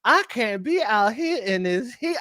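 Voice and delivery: mock Georgia-belle accent with swooping pitch; imitated Georgia accent. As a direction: drawl it like a Georgia Southern belle. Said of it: "she be doing this accent".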